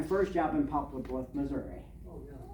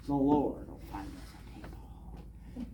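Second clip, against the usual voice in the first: mocking mutter; 4 semitones lower, 8 dB quieter, slower than usual. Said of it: "That made me mad".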